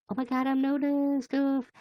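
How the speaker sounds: high, squealing delight at being noticed; excited squeal. Real, not acted. Streamer squeals to her chat about being noticed, thrilled.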